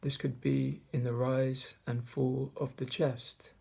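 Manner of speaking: measured meditation guide, long pauses between phrases; guided meditation. Acted. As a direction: guide listeners through the breath, leaving space after each phrase. Leads a mindfulness meditation.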